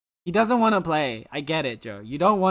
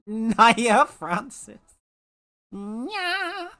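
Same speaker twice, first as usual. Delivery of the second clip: drawn-out name; 7 semitones higher, 4 dB louder, much slower than usual. Acted. Drawing out a viewer's name.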